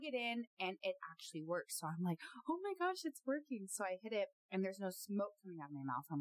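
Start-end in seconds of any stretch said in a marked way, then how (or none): 2.5-3.7 high, delighted squeal; imitating her own thrilled relief; excited relief. Telling viewers how she reacted: mock excited squeal. Retelling the relief when it worked.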